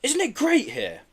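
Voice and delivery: put-on a boy in awe of the view, slowly; high, slow and marvelling. A narrator's reading. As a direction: let the wonder stretch the line out. Child marvelling at scenery.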